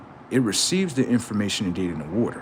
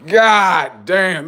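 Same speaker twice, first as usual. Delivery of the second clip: higher, louder drawn-out God damn; stunned exclaim; 8 semitones higher, 8 dB louder, much slower than usual. Retold or imitated. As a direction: act floored by what he heard. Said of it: "I was like".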